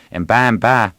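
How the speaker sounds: ordinary delivery, nothing marked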